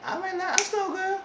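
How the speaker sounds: mock high reaction. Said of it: "I said".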